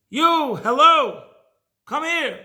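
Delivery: loud, high hail across a road; calling out. Retold or imitated. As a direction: call to a passerby from afar. Stranger calls out from roadside.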